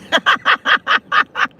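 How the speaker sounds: knowing high laugh; short laugh, pitch jumps up. Real, not acted. Laughs at meal and woman.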